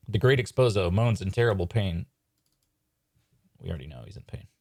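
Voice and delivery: usual speaking voice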